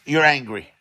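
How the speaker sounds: normal talking voice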